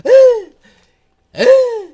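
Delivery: shrill scared shriek, high; startled scream. Retold or imitated. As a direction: scream like you got jumped. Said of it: "she's like".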